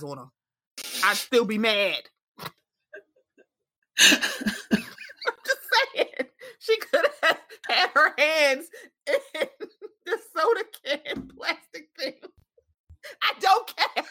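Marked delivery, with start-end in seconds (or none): 4.0-14.1 high, giggly, words breaking into laughter; laughing through her own rant; laughing while talking. Real, not acted.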